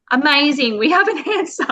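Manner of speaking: light up at finally getting an answer; excited relief. Retold or imitated. Mum retelling her relief at the diagnosis, excited and laughing. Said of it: "So I was like".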